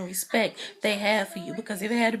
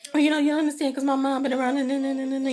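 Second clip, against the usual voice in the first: higher, louder imitation of a tearful plea; mock near-tears voice; 5 semitones higher, 4 dB louder, faster than usual. Kandi pleading that her mom been around, mock tearful. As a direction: imitate someone getting worked up to cry. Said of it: "her voice got all crackly".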